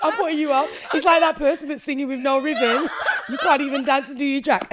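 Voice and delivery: laughing while teasing; cracking up mid-sentence. Real, not acted.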